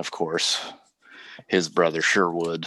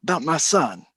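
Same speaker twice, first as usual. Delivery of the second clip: furious demand; 8 semitones higher, faster than usual. Acted. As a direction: demand an answer, seething. Cornelius enraged at Bill.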